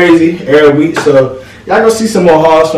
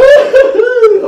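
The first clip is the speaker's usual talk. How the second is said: burst of laughter. Real, not acted. Laughing at the cheetah print.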